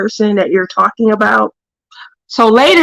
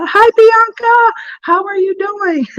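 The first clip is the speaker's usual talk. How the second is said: delighted greeting; 10 semitones higher than usual. Real, not acted. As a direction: greet a friend in a lifted, lilting voice. Greets a viewer joining the stream.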